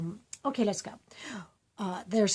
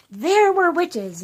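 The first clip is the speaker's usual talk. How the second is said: put-on higher, louder, slow child's voice; child's answer; 7 semitones higher, 8 dB louder, slower than usual. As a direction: say it like an eager kid. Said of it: "And they say".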